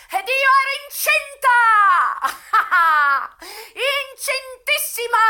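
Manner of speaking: hysterical laugh-cry. Acted. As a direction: burst out in bitter laughter and tears.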